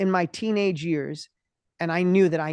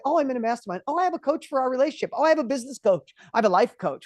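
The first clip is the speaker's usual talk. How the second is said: higher, quick, casually showing off; breezy mock bragging; 7 semitones higher, 4 dB louder, faster than usual. Dinner guests today casually brag about coaches, breezy mock boast. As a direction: toss it off like a proud dinner guest.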